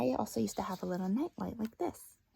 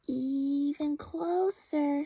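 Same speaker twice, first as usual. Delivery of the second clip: drawn-out, coaxing call; the dark luring Laszlo closer; slow beckoning; 6 semitones higher, 4 dB louder, much slower than usual. Acted. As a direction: stretch each word to lure him.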